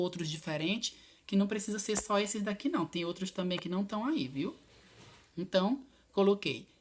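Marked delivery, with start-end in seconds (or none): none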